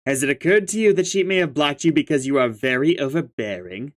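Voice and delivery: ordinary talk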